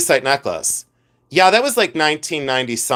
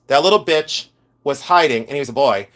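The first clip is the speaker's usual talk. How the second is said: ordinary delivery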